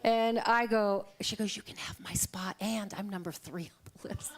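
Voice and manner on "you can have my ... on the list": much softer, lower, laughing; hushed laughing tease. Retold or imitated. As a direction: murmur it with a chuckle. Friend's teasing offer retold.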